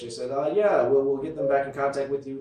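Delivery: ordinary talk, nothing marked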